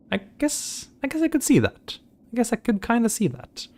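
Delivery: high-pitched squirrel voice. Pretend. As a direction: talk like a tiny squirrel. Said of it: "I have good squirrel voice, apparently".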